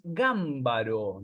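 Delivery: "Gámbaro" with the stress on the first syllable, GAM-ba-ro; correct pronunciation. Shown as a demonstration. Teacher giving the correct surname: "Gámbaro" stressed on GAM. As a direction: say "Gámbaro" as an esdrújula, leaning on GAM. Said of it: "lo voy a pronunciar como se debe pronunciar".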